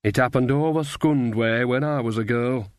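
ordinary delivery, nothing marked

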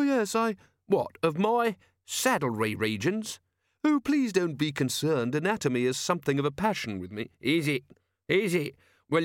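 lightly voiced bickering inventor and grumbler; story characters in dialogue. A narrator's reading.

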